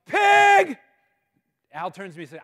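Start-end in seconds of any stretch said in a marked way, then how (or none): 0.1-0.8 sudden full-voice, high shouted warning; yell. Retold or imitated. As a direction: yell it out from a passing pickup. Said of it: "and he yells. He yells".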